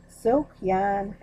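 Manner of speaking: ordinary delivery, nothing marked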